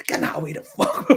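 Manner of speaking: unvoiced hurried warning; urgent whisper. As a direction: hiss it before the police see. Friend's hissed warning retold.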